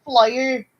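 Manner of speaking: "Flyer." with a Northern Irish "fly-er" vowel; Northern Irish flour. Retold or imitated. Sarah copying Nadine's Northern Irish "Flyer.". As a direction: echo "Flyer." the Derry way. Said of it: "It's like Derry Girl".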